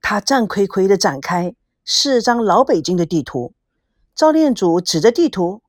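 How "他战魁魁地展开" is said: plain reading voice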